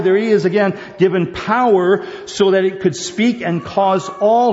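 preaching with mounting fervor; fervent preaching. Acted. Preacher building fervor over the beast's power. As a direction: drive the verse home to the pews.

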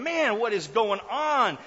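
loud, slow, bewildered king; baffled Pharaoh. Pretend. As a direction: groan it out in confusion. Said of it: "Pharaoh's going".

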